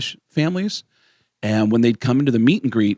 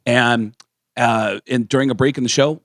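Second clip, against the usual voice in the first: ordinary talk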